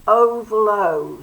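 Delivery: slow, loud long O in oval; long O demo. Shown as a demonstration. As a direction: stretch the long O, like showing a class. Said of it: "here we go with the rugby ball".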